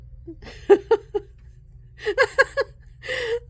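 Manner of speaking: loud, very high laughing fit; real uncontrollable high cackling; helpless laughter. Real, not acted. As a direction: lose it laughing. Cracking up over horns card.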